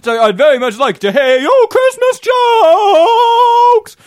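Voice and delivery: belted high, very loud and drawn out. Acted. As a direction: boom it out like a hammed-up diva. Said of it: "And it's me, [name]".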